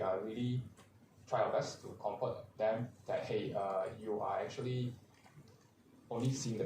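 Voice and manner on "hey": ordinary talk, nothing marked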